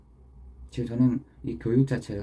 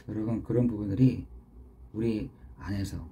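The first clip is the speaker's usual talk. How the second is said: ordinary delivery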